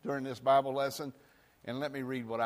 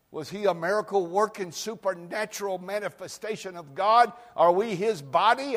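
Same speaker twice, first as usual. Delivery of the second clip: raised, louder preaching voice; 7 semitones higher, 8 dB louder than usual. Acted. Pastor preaching to his church, rising and loud. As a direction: preach it out to the congregation. Preacher building to full force.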